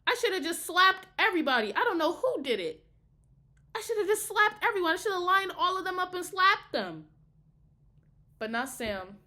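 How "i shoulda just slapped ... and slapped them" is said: high, loud, fast rant; worked-up, exasperated outburst; heated venting. Real, not acted. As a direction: rant at full tilt, voice pitched up. Venting about freshman-year roommates.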